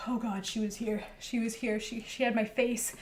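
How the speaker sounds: softly acted fright; hushed, a touch of fear on waking; Brogel, shaken. Game master as Brogel after the nightmare, hushed fright.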